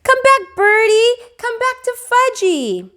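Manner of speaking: put-on very high loud toddler scream. A narrator's reading. Fudge screaming at pigeons as they fly off, high and loud. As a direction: scream after the birds like a little kid. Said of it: "was screaming".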